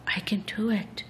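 soft muffled whisper. Acted. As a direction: barely breathe the words. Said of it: "a soft, muffled voice was heard to say".

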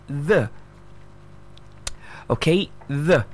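"the" with a voiced th and short uh; correct pronunciation. Shown as a demonstration. Teacher showing the right way, careful pronunciation. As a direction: say "the" with tongue between the teeth. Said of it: "the right way you pronounce the word".

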